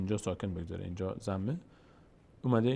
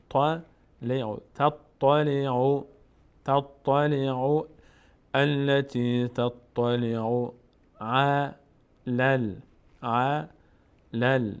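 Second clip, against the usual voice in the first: very drawn-out, measured syllables; slow recitation demo; 3 dB louder, much slower than usual. Shown as a demonstration. Teacher repeating words for learners, slow recitation. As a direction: recite each piece slowly, holding the vowels.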